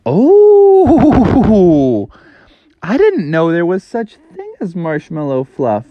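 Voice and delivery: character voice of a surprised frog; surprised Froggy. A narrator's reading. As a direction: sound amazed, like a kid learning something new. Froggy amazed by fluff.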